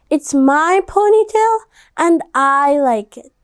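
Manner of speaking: put-on defiant child voice; defiant girl. A narrator's reading. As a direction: stand up for yourself, proudly. Stephanie answers bullies.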